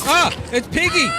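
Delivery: high excited shout. Real, not acted. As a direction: yell it out, thrilled. Killer Piggy spotted.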